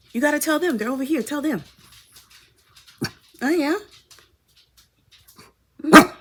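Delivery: high coaxing pet talk. Real, not acted. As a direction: tease the cat along, sing-song. Chatting with the cat.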